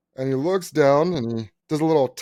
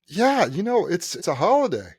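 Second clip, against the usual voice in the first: higher-pitched imitation of a receptionist; voiced receptionist; 7 semitones higher, 3 dB louder than usual. Lady receptionist answering the older guy, high imitation. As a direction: put on the lady's lilting desk voice.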